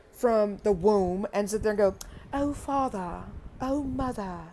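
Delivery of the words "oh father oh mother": lightly voiced prim little child, a bit higher; prim toddler. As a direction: do a mock-formal baby addressing its parents. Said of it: "they're not gonna talk like that".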